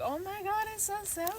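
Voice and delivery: high-pitched fake shock at sourness; mock sour reaction.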